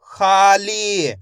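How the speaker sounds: खाली very slow, both vowels held; a loud long खा, then long ली in खाली; stretched pronunciation. Shown as a demonstration. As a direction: blend खाली slowly for learners. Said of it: "मैं इसका खींच के उच्चारण कर रहा हूँ".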